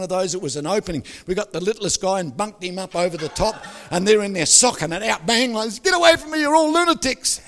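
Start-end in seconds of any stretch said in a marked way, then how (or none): 5.8-7.4 much higher, louder shriek; woman locked in toilet screaming at the men. Retold or imitated. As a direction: scream through the toilet door. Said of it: "she's screaming".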